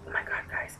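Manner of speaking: breathless whispered oh my God; awed whisper. Real, not acted. To viewers after tasting her shake, whispering in awe. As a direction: whisper it as if stunned by the taste.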